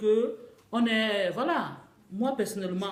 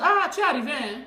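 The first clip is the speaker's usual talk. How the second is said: high, loud greeter's exclamation; mock eager greeting; 5 semitones higher, 5 dB louder than usual. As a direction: play someone pouncing on a new arrival. Acts out an overeager greeter. (salut enthousiaste imité, voix haute)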